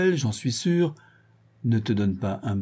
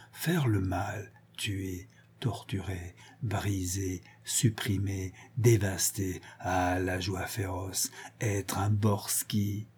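hushed ferocious relish; 3 semitones lower, 8 dB quieter, slower than usual. Acted. Villain savouring cruelty.

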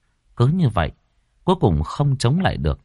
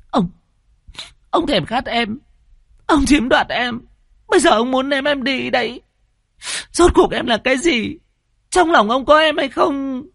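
put-on accusing young woman. A narrator's reading. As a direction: confront him shrill and bitter. Maid turns on him.

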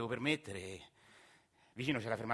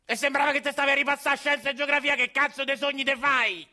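much higher, much louder furious yell; angry shout; 14 semitones higher, 10 dB louder than usual. Acted. Grandfather yelling at dreaming Agostino, loud furious shout. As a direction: bark him awake, fed up and furious. Angry old man scolding.

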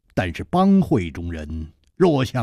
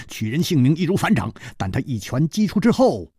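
usual speaking voice

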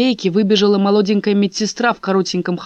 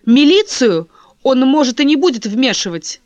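loud; 6 semitones higher, 7 dB louder than usual. Acted. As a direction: speak up over him. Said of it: "громко заговорила Лиза". (громко)

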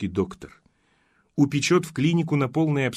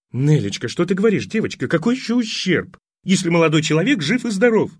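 higher, louder doting mother; fussy old mother; 7 semitones higher, 6 dB louder than usual. Acted. Mother scolds her daughter for worrying the young man, higher and louder. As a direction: fuss and protest over him.

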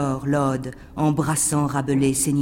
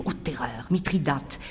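a single whispered name; 3 semitones lower, 9 dB quieter than usual. Acted. Poet reciting verse, whispering the name. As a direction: drop to a breath on the name.

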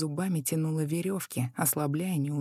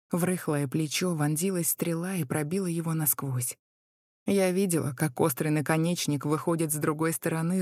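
plain reading voice

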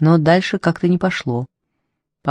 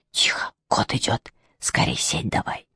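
hushed, low whispered warning; urgent whisper; 8 semitones lower, 8 dB quieter than usual. A narrator's reading. Demon warning his partner the cat is coming, whispering. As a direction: whisper it so the cat won't hear.

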